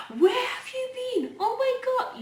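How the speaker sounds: high, surprised cry of disbelief; shocked exclamation. Retold or imitated. Mother stunned to hear from long-lost father, surprised. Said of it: "And she was like".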